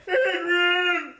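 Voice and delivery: high, drawn-out mock cry of triumph; hammed-up impression, stretching out the words; movie parody. Man parodying a film hero calling Adrian, hammed-up cry. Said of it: "You do sound like him".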